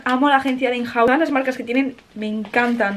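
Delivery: normal talking voice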